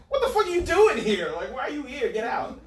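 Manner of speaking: high, loud, indignant teacher impression; mock outrage. Teachers to the kid helping out, mock outraged.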